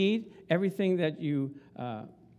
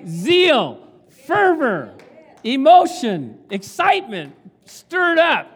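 loud, rising burst of passion words; fervent preaching; 5 semitones higher, 11 dB louder, slower than usual. Shown as a demonstration. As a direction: stir the crowd with each word. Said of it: "Can I say it again?".

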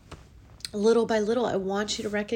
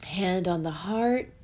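ordinary talk, nothing marked

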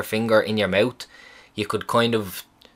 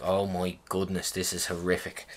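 a patient told to pull his pants down, muttering in quiet dismay; hushed dread; 3 semitones lower, 10 dB quieter than usual. Retold or imitated. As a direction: say it under your breath, appalled. Inner dread at pulling pants down.

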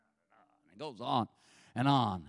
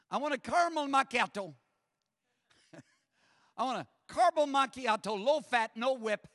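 lightly voiced fast orderer, higher; imitating Gary ordering; 6 semitones higher than usual. Gary ordering at the drive-through speaker, mild mock voice. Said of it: "he speaks into the speaker".

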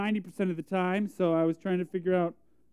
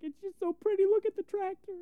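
high, soft hammed-up blubbering; mock weepy; 11 semitones higher, 5 dB quieter than usual. Crier gushing over a tractor to critics, mock weepy. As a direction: squeak it out as if overcome by beauty. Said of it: "you know I'm a crier".

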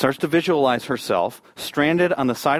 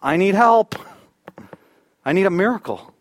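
raised, pleading prayer; praying for help; 5 semitones higher than usual. Retold or imitated. Stranded mother praying to God for help, pleading. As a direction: pray it out, rising higher on the plea. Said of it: "she was praying".

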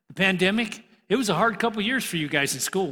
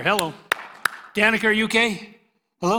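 louder call across the room to wake her; teacher calling to a sleepy student, louder and teasing; 4 semitones higher, 6 dB louder, faster than usual. Real, not acted. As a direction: call out to a dozing listener.

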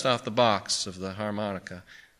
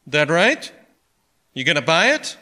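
pointed, louder questions with raised pitch; sharp questioning; 7 semitones higher, 8 dB louder than usual. A narrator's reading. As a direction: challenge the customer, pitch jumping up. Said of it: "looked at Barney sharply".

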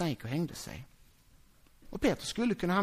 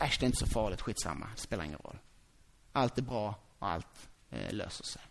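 much lower, softer, offhand; careless shrug; 11 semitones lower, 7 dB quieter than usual. Acted. Preacher mocking the ditch of not caring, low and offhand. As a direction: toss it away lazily.

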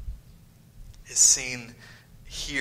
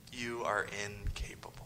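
cold whisper; 3 semitones lower, 10 dB quieter than usual. Acted. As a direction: hiss the verdict quietly. Whispered verdict.

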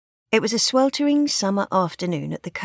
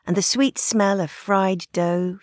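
usual speaking voice

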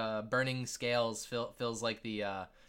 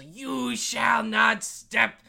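high, loud, drawn-out dragon proclamation; kid playing dragon; 7 semitones higher, 6 dB louder, much slower than usual. Game master as a kid voicing a dragon, grand challenge. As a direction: boom it slowly like a grand guardian. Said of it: "And then the kid goes".

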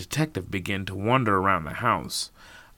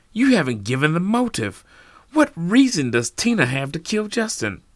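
heated challenge; 9 semitones higher, 7 dB louder than usual. Acted. Norman challenges detective.

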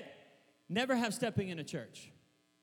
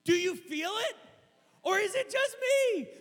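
excited call; 13 semitones higher, 6 dB louder than usual. Real, not acted. Calling out to congregation.

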